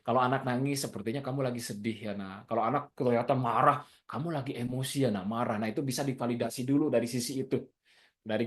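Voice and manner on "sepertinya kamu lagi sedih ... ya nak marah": much softer and lower, tender; gentle validation. Shown as a demonstration. Parent validating a crying child's feelings, soft and tender. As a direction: soothe the child softly. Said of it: "maka validasi emosi di balik gestur tubuhnya".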